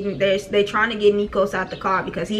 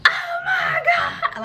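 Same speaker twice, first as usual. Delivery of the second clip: very high, drawn-out panicked wail; panicked cry; much slower than usual. Retold or imitated. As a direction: re-enact a full-blown panic shriek. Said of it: "I went into a full-blown panic".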